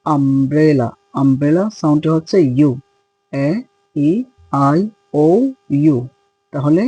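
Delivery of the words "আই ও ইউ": ordinary talk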